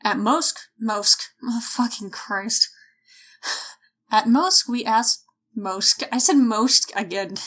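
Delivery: ordinary delivery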